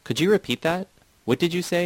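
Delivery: high, pointed narrated question. A narrator's reading. Startled listener in a hostile circle questioning Titus, high demanding question. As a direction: press him to repeat himself, pitch lifted.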